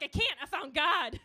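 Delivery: loud, high comic panic; panicked reply. Acted. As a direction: shout back in mock desperation. Acts out her panicked reply.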